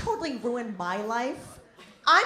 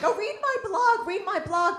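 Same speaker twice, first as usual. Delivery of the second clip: needy blogger friend; 9 semitones higher, 4 dB louder than usual. Retold or imitated. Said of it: "they're like".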